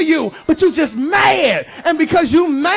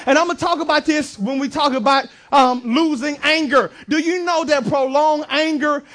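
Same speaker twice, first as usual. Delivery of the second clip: ordinary talk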